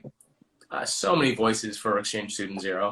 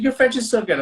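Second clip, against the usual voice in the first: imitating sweet praise, high and louder; sweet encouragement; 12 semitones higher, 6 dB louder than usual. Wife praising the same few French phrases, imitated sweet gushing. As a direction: coo high and loud like an adoring wife. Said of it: "she's so sweet".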